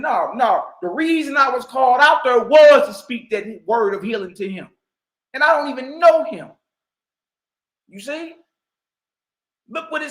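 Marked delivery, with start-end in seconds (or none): none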